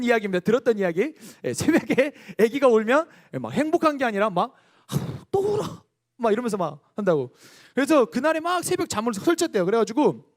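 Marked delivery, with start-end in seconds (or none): 4.9-5.8 high, fed-up sigh of a tired father; exasperated new father; annoyed dad. Retold or imitated. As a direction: groan it like a dad woken again. Father groans at crying baby.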